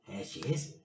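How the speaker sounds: usual speaking voice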